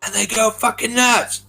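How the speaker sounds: sudden high hammed-up outburst; mock over-the-top. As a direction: ham it up like a frantic action film. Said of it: "it's never like".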